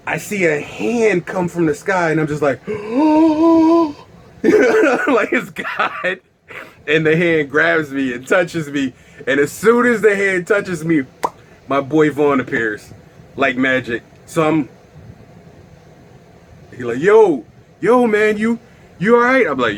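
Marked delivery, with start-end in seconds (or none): 2.7-3.9 high, loud drawn-out gasp; awestruck gasp. Acted. Tripper sees a hand from the sky, awestruck gasp. 17.0-19.5 higher, louder voice of a worried buddy; concerned friend. Retold or imitated. Friend finds him tripping on the floor, raised concerned voice.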